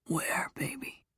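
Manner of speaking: very soft, husky question; lover's whisper. A narrator's reading. He asks her where, close at her ear, whispering. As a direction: whisper it intimately.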